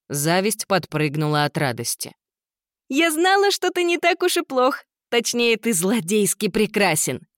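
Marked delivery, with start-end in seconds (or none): 2.9-7.3 very high, delighted gushing voice. Acted. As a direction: squeal with admiration. Envy gushes with joy.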